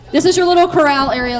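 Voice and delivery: ordinary talk, nothing marked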